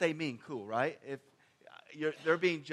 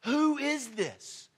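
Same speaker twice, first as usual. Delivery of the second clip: awed question; 8 semitones higher, 4 dB louder, much slower than usual. Retold or imitated. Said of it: "they, they were like".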